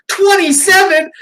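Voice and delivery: mock outraged boyfriend; shocked disbelief. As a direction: gape at the number. Boyfriend shocked in retold film.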